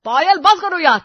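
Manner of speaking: put-on high, loud snap at a girlfriend; fed-up boyfriend shouting; exasperated shout. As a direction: yell at your partner to stop. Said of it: "तुम मुझ पर चिल्लाए".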